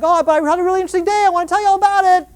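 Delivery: fast, high, bubbly rush of excitement; eager chatter. Retold or imitated. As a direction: gush about your day. Eager partner wanting to chat.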